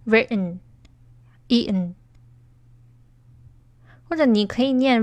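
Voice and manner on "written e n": written, E N drawn out very slowly, letters spelt apart; slow demo. Shown as a demonstration. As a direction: spell out written, E N slowly for learners. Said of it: "美式发音很难读".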